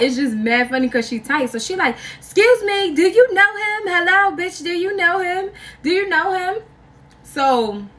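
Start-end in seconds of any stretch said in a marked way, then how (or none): 2.2-6.6 high, insistent questioning, louder at the start; mock jealous interrogation. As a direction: pester them like the jealous girlfriend, pitched up. Girlfriend's angry questioning retold.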